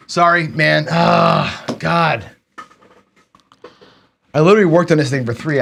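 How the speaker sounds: apologize, then groan out your frustration; real exasperation. Real, not acted. Streamer apologizing for lost work, loudly exasperated.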